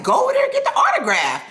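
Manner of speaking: very high mock cousin voice; pushy cousin. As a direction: cousin Dre urging her to get the autograph, mock high voice. Cousin voiced pushing her on.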